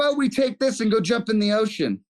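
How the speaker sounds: teasing imitation of a buddy; mocking friend. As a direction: ham up a friend's innocent invite.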